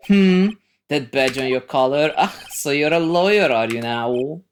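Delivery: grand lawyer character. Acted. Lawyer inspects the young visitor's badge, pompous. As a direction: size up the newcomer grandly.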